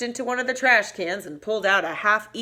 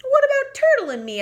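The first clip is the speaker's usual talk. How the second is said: high, squeaky little voice; squeaky mouse; 8 dB louder, faster than usual. Acted. Mouse squeaking to Bear about the game.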